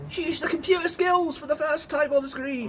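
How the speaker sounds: excited. Real, not acted. Excited praise of companion.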